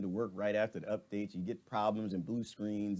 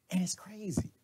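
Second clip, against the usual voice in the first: breathy whisper, higher; whispered aside; 7 semitones higher than usual. Real, not acted. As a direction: lean in and whisper it. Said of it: "whispered aside".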